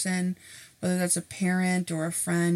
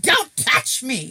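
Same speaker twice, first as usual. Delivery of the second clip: higher, louder, slow mock cry; mock yelp; 7 semitones higher, 7 dB louder, slower than usual. Acting a shopper flinching from the clerk, loud. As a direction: recoil with a hammed-up yelp.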